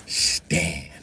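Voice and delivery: ordinary delivery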